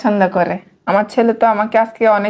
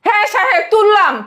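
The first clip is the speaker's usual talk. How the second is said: high, defiant talk-back; 11 semitones higher than usual. Acted. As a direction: throw it back at the master. Maid defiant to master. (উঁচু গলায় উদ্ধত জবাব)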